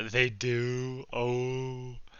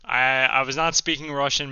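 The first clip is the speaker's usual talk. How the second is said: loud protest; 3 semitones higher, 8 dB louder than usual. Real, not acted. Denying speaking Russian.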